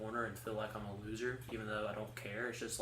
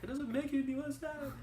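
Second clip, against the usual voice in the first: much higher, a lightly hammed-up protest; high mock protest. Answering her teasing sister, high mock protest. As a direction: pitch it way up in mock defence. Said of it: "I'm like".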